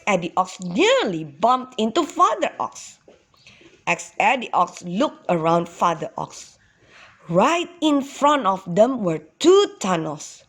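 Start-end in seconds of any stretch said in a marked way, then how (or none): none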